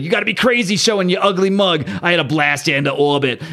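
a hint of a tough brawler, put-on voice; gruff Thing voice. The Thing snarling at the Puppet Master in a mildly put-on tough voice. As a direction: lightly voice an angry rocky hero.

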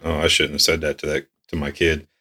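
zacht en laag in zichzelf gemompeld; muttered regret. Retold or imitated. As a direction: mompel het beschaamd voor je uit. Catching yourself cursing at kid.

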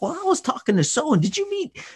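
high, bright, eager greeting; excited social butterfly. Retold or imitated. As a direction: gush over everyone at the party. Acting himself greeting people at party.

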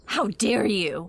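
offended retort. Acted. Girl offended at familiarity.

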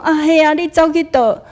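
bright loud hello to a neighbor; cheery greeting. Retold or imitated. Auntie greeting the speaker on the street, loud and cheerful. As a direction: call out happily to an old neighbor.

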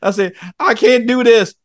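high, loud wail of a defeated father; desperate plea. Retold or imitated. Complaining to God as a failing dad, high and loud. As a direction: cry out to God. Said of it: "I'm like".

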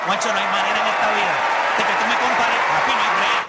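heated protest to his friend Juancho, loud; loud insistence. Real, not acted. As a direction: talk over him, insisting. Heated argument with co-host.

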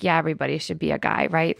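put-on low, fast, dry teenage voice, lightly done; tossing it off like a bored son; imitating her son. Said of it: "And Joe was like".

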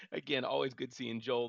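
ordinary talk

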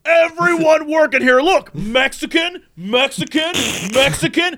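high-pitched accusing tirade; mock ranting. Shopper accusing store workers, mock outraged rant. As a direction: imitate an indignant man pointing people out.

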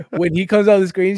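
usual speaking voice

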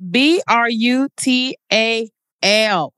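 B R U T A L slowly, letter by letter; slow, deliberate B R U T A L; spelled out emphatically. Real, not acted. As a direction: spell B R U T A L with each letter set apart. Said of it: "with a capital B".

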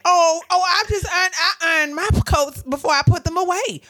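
high, loud mimicked voice; exaggerated flustered imitation; Rhonda. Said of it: "Rhonda is like".

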